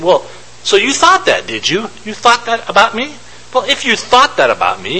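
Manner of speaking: a hint of an indignant master; mock scolding master. Master scolding servant, retold.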